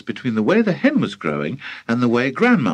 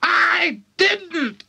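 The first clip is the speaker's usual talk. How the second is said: high screechy granny cackle; gleeful high crone voice; cackling old woman. A narrator's reading. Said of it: "cackled the old woman".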